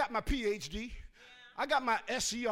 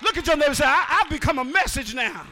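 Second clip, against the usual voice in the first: full-force preaching; 3 semitones higher, 13 dB louder than usual. Real, not acted. Pastor exhorting the congregation, full-force preaching. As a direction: preach it to the back row.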